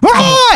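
very high imitated bird cry; mock eagle screech. Bald eagle hit by lightning, mock screech.

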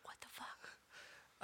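unvoiced slow whisper. Friend whispering her stunned reaction to the book. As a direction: breathe it out in disbelief. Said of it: "walking around like".

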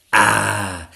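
loud, drawn-out teacher's ah; pleased dragon teacher. Acted. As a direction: greet the pupil's success aloud. Dragon teacher greets success.

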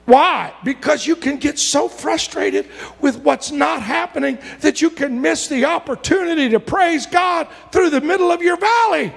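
rising from urgent to a high, ringing shout; preaching at full force. Acted. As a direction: drive it home to the congregation.

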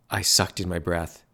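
ordinary delivery, nothing marked

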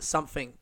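"something" said as "sumfing". Shown as a demonstration. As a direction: say "something" with an F in the middle. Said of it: "you know how some people say".

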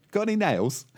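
squeaky duck voice, all innocence. Acted. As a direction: pitch it up, innocent duck asking. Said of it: "And the duck goes".

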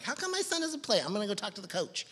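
mom voice. Retold or imitated. Voicing mom complaining about bench.

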